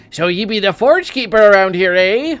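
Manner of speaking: put-on high, loud grizzled old prospector voice. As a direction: play a crusty old miner bird hailing strangers. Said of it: "An old prospector bird covered in dirt hails you".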